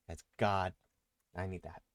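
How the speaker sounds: normal talking voice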